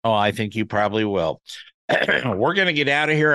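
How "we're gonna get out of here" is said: hoarse, throat-clearing voice; frog in throat. Real, not acted. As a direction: catch in the throat. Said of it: "I'm sorry for the frog in my throat".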